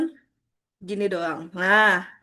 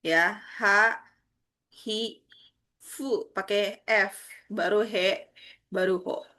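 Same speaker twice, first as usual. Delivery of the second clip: slow demo; 4 dB quieter, much slower than usual. Shown as a demonstration. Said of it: "di H itu dia Hahifu".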